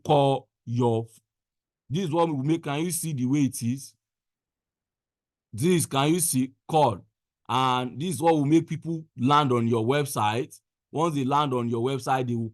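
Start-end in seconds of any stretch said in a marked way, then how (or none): none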